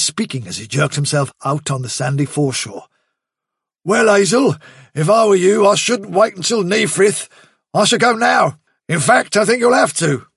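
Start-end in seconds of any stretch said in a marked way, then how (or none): 3.8-10.3 higher, louder, pressing warning; a tough rabbit urging the leader to move now; breathless soldier rabbit; Bigwig's urgent voice. A narrator's reading.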